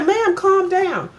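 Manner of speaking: high, slow, soothing mock cashier voice; calm the upset customer down, drawn out; imitating the cashier. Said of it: "She said".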